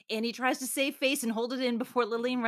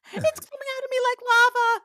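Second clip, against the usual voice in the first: high, loud, through laughter; laughing mock panic; 13 semitones higher, 7 dB louder than usual. Cohost quoting the bridesmaid, laughing mock panic. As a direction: blurt it out cracking up. Acting movie line while laughing.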